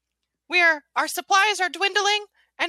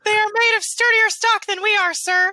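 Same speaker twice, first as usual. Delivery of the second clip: earnest officer reporting, a bit louder; soldier voice; 4 semitones higher, 4 dB louder than usual. Acted. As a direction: report to your general, firm. Gingerbread officer reports to general.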